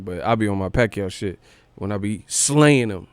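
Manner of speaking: ordinary talk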